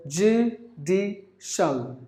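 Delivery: "judicial" drawn out as joo, dee, shul; slow three-beat "judicial"; syllable by syllable. Shown as a demonstration. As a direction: pause after each part of "judicial". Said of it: "Three syllables".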